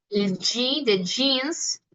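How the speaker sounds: very slow G de jeans, said as in English jeans; teacher compares the Italian G to the G de jeans; English jeans sound. Shown as a demonstration. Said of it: "la palabra jeans".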